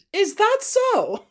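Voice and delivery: high, slow, sugary menace; ominous smile. Retold or imitated. Imitating Kasumi unsettling the family, ominously sweet. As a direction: draw it out through an ominous smile. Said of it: "having this really ominous smile".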